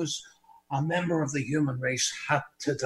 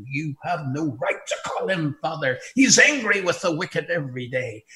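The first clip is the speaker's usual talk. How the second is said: ordinary talk, nothing marked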